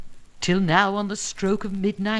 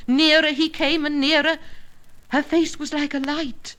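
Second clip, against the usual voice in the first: ordinary delivery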